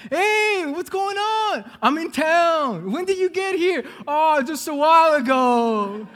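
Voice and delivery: high, loud, drawn-out phone hello; excited greeting. Acted. Nephew and uncle reuniting by phone, excited. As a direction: call out long, high, delighted greetings.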